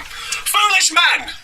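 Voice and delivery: drawn-out, very high mock preacher cry; Preacher Adam. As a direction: denounce like a squealing caricature preacher. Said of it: "He says this, Preacher Adam".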